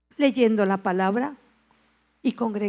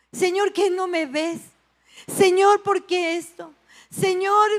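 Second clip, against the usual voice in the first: higher and louder, pleading with God; anguished outcry; 7 semitones higher, 6 dB louder than usual. Retold or imitated. Believer calling out to God in pain, higher and louder. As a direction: cry out why he doesn't see you.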